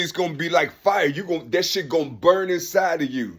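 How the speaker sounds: usual speaking voice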